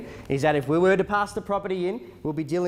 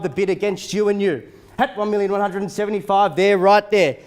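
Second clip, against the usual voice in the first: auctioneer calling bids; 3 semitones higher, 3 dB louder than usual. Acted. Live auction bidding calls.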